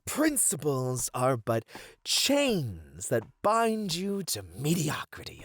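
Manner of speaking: normal talking voice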